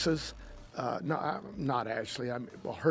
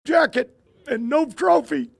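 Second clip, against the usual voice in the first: put-on higher, aggrieved kid voice; imitating a distraught child, higher and louder; upset grandson; 9 semitones higher, 7 dB louder than usual. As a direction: play the hurt young boy, voice pitched up. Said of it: "he was freaking".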